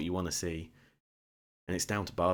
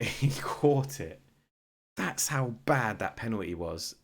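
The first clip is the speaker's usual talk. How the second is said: laughing disbelief; 4 semitones higher, 4 dB louder, slower than usual. Real, not acted. Keeper catches awful penalty.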